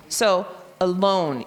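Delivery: slow demo with "alone" stressed on the second syllable; accent on LONE. Shown as a demonstration. Teacher slowly showing kids the accented syllable, stress on LONE. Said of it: "the second syllable is the one that's accented".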